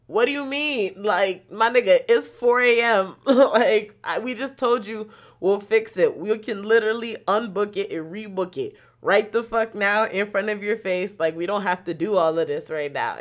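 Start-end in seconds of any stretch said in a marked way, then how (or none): 0.1-11.1 exasperated. Retold or imitated. Arguing with the driver.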